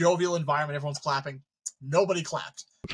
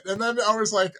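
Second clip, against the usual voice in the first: ordinary talk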